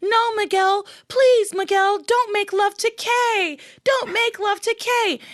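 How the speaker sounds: high, pleading imitation of screaming; mock scream. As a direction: wail it out, hammed-up and drawn out. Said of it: "she starts to scream".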